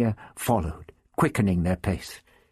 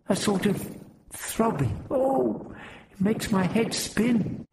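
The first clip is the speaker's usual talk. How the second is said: dizzy exclaiming; 12 semitones higher, slower than usual. A narrator's reading. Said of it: "Helenia exclaimed".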